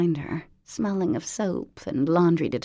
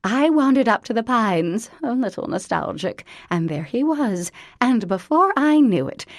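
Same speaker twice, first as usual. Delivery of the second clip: gushing mother; 5 semitones higher, 6 dB louder than usual. A narrator's reading. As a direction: lay the charm on thick. Mother gushes to Collier.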